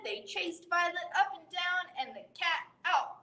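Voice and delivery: plain reading voice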